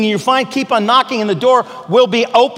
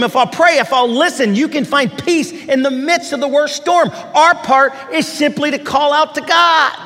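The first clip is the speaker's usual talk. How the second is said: higher, impassioned sermon delivery; preaching at full force; 6 semitones higher than usual. Acted. Preacher urging listeners never to stop praying, impassioned. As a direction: pastor pleading with the congregation to pray, full preaching voice.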